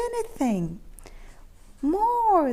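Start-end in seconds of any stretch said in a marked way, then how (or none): 1.8-2.5 high, eager cry; excited cry. A narrator's reading. Cats excitedly begging Keith for more magic. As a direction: call out for more. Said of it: "the cats cried excitedly".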